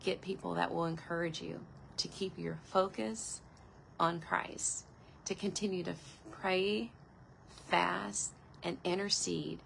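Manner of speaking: usual speaking voice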